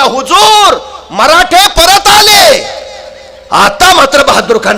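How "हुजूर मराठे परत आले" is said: loud, higher, slow alarmed shout; spy shouting. Acted. Spy at the rear shouts to Bahadur Khan, alarmed shout. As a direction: shout the warning across the field. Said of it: "हेर ओरडला".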